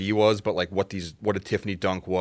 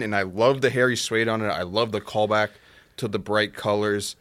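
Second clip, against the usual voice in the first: ordinary talk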